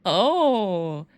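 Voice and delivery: loud wicked laugh rising then falling; a cruel, delighted cackle at his expense; evil cackle. Acted. Demon laughs cruelly at Rookie's discomfort.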